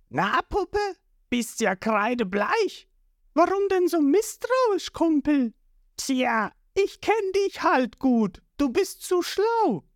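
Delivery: high, teasing thug voice; sly fishman. A narrator's reading. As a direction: needle her like a smug gangster. Fishman and Nami exchange.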